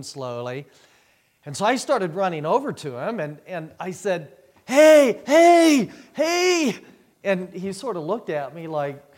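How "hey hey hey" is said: high, very loud repeated calls; repeated hollering. Retold or imitated. Running toward the man on the lava, hollering. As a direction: yell to catch someone far away. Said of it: "hollered out".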